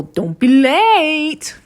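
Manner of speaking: high, loud, drawn-out mock call; imitated taunting call. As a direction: sing it out slowly up high, teasing. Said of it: "he gets the key and it's like".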